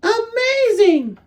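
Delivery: very high, slow, drawn-out Amazing; delighted amazement. Acted. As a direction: stretch out Amazing in wonder.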